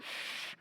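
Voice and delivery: sharp unvoiced shh to silence someone; hush. Henry hushing the thumping duplicate in the tool chest, urgent shh. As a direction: hiss a quick shh for silence.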